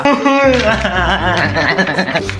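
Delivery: long run of mock sobs; hammed-up crying. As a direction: bawl despite being comforted. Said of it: "wag ka na umiyak". (hagulgol na OA)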